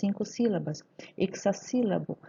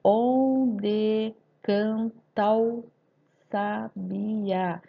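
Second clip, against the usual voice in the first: "Onde canta o sabiá" slow in syllables, "canta o" fused as "can-tao"; poetic scansion; much slower than usual. Shown as a demonstration. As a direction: chant out the syllables slowly, gliding "ta o" into one. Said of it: "na escansão fica um pouquinho diferente". (escansão poética, juntando "canta o" em "can-tao")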